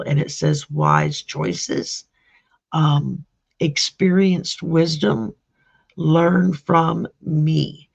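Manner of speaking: reading the card's words slowly and deliberately. Shown as a demonstration. Reading the owl card's message slowly to Selena. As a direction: let each word of the card land, slowly. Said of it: "what I drew for you is the owl".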